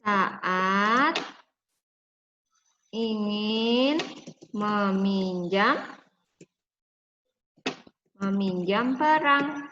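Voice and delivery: put-on slow, drawn-out words for kids to write; slow dictation. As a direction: spell it out slowly for young pupils.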